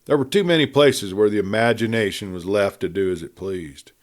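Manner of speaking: ordinary delivery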